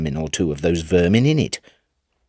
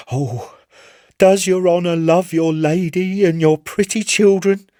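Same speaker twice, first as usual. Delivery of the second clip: pleading sailor; 9 semitones higher than usual. Acted. Chips on his knees begging the Admiral, high and urgent. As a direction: beg for everyone's lives in a high strained voice.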